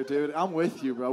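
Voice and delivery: normal talking voice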